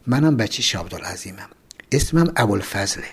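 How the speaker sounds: hushed, breathy whisper of a secret. Acted. Cellmate secretly confiding to the narrator, hushed whisper. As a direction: lean in and whisper it so nobody hears. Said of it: "بعد یواشکی گفت".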